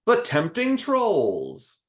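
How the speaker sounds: high, very slow ominous clown. Acted. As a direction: draw it out like a spooky clown. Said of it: "Rococo responds".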